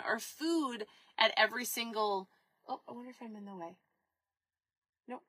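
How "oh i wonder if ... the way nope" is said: quick, much softer aside under her breath; a hushed quick aside; quiet aside. Real, not acted. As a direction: drop it to yourself as someone passes. Muttered aside to someone off camera.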